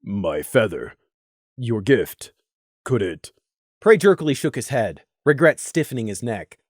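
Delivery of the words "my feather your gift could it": low, soft, steady voice; a quiet, heavy character voice; low, gentle character voice; Crimson's voice. A narrator's reading. Crimson speaks in story.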